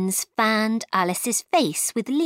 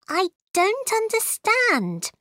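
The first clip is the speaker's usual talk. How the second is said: put-on much higher, girlish puzzlement; puzzled Alice; 13 semitones higher than usual. Alice tells the queens about her country, high and puzzled. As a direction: protest in a high, bewildered voice.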